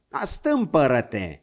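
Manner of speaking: put-on exasperated old hunter yelling at a bucking horse; shouting at the horse. A narrator's reading. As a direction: bark an order to quit kicking.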